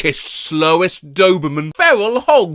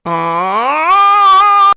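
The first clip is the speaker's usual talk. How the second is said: high yelping scream; mock scream; 12 semitones higher, 4 dB louder than usual. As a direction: let out a silly startled shriek.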